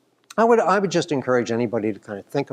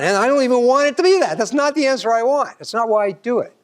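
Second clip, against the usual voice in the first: exasperated insistence; 12 semitones higher, 5 dB louder than usual. Real, not acted. Lecturer insists on his real aim.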